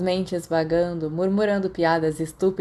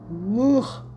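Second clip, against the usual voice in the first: a higher "urgh" of disgust; disgusted groan; 4 semitones higher than usual. Acted. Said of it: "Sirius fez uma careta quando viu".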